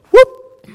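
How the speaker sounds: sudden loud, high-pitched whoop; short swooping noise for something sailing past. Retold or imitated. Woman ignoring what was said, sudden high whoop. Mimes question going over her head.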